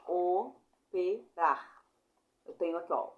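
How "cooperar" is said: "Cooperar" sílaba por sílaba, co-o-pe-rar, com pausas; separação silábica. Shown as a demonstration. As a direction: diga "Cooperar" bem devagar, cortado em sílabas. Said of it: "vogal O e vogal O". ("Cooperar" escandido, com pausa entre os dois O)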